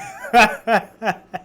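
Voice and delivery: a short real laugh through tears; quick loud burst of laughter. Real, not acted. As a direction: laugh it off, a little embarrassed. Laughing at her own tears.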